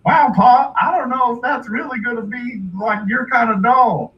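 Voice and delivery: high, loud doubtful son; hillbilly son. Retold or imitated. Son doubts Pa's doll choice, high hillbilly voice. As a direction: pipe up to Pa in a rube's voice. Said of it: "his son's like".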